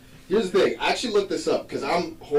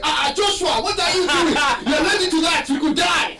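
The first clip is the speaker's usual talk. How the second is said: high, loud panicked shout; imitating panicked mom; 6 dB louder than usual. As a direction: yell at your kid in alarm, higher and louder. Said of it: "She's like, freaking out".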